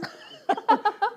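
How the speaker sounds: high, loud burst of laughter. Real, not acted. Actor joking about quarrelling on set, high loud laugh. Laughs at his own joke.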